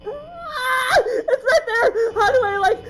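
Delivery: high, loud shouts rising to a shriek; screaming panic. Real, not acted. Player spots the animatronic right there, screaming panic. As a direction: cry out in real terror. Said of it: "this whole thing's gonna be me screaming".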